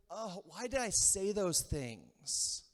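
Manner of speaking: slow, drawn-out rueful self-reproach; regretful. Acted. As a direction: agonise mildly over words already said. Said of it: "You ever ask that question?".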